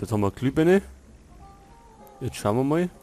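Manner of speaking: ordinary talk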